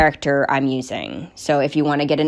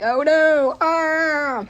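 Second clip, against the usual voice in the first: mock panic; 12 semitones higher, much slower than usual. Players realizing the DM's trick.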